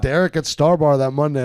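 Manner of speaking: normal talking voice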